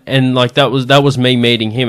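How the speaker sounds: normal talking voice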